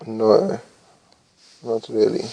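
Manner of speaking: usual speaking voice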